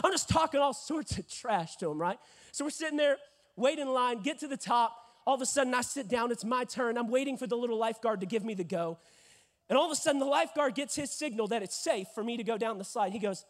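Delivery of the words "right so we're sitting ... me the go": ordinary talk, nothing marked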